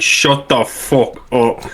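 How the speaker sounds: drawn-out slow shut the fuck up; slow put-down. Retold or imitated. Telling the drunk bully off, slow and deliberate. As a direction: stretch every word out. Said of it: "I was like".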